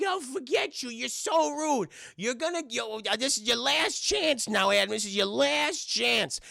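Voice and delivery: high, drawn-out huffy cartoon voice; indignant Whitey. Whitey giving Davey a last chance, indignant high voice. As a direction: stretch out the offended warning up high. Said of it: "And Whitey's like".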